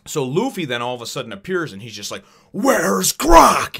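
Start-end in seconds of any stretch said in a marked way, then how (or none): 2.5-3.8 high, loud demanding yell; angry shout. Retold or imitated. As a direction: bellow it in a mad rage. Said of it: "he's mad".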